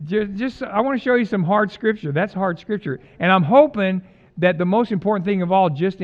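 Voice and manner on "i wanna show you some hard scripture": normal talking voice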